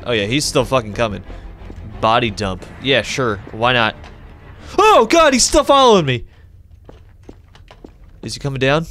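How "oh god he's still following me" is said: high, loud fearful cry; scared shriek. Real, not acted. Player followed by the spider, yelping in fear. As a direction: yelp as it follows you.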